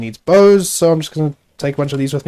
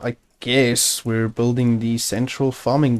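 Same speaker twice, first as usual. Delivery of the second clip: normal talking voice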